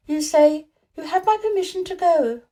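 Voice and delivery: put-on high, eager little girl explaining; a bright young girl telling Colin the phrase; Mary, girl's voice. A narrator's reading. Mary answers Colin, high girl's voice.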